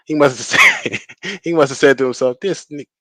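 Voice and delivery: real laughter breaking into the story; too amused to finish the line; laughing while talking. Real, not acted. Cracks up telling his story.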